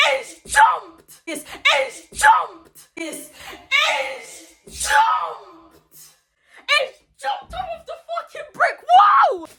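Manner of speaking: high-pitched storyteller's outburst, building to a shout; escalating, drawn-out shrieks of disbelief; incredulous exclaiming. Real, not acted. Acts out shock at sudden jump.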